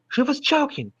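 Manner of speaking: high, emphatic realisation; shocked exclamation. Shown as a demonstration. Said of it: "She, she was choking?".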